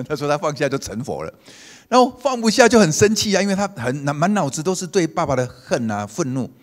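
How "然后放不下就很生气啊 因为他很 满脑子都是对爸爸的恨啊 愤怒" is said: much higher and louder, heated; rising agitation. Real, not acted. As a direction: preach it with building heat.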